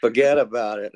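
a quick New York accent, mock tough-guy. Cohost teasing Jason's New York accent, playful. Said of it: "sometimes I slide into my New York accent".

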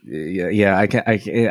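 ordinary talk

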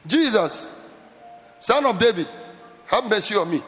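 mid-strength appeal for mercy; next gear up. Shown as a demonstration. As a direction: call out firmly, short of a shout. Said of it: "he shifted to another gear".

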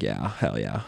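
low, swooping surfer-dude voice; hammed-up low surfer impression; mock California surfer kid. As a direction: imitate a surfer buddy, pitch dipping and bobbing. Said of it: "And he talks like this".

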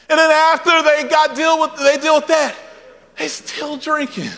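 high, ringing preacher's cry; preaching at full force. Acted.

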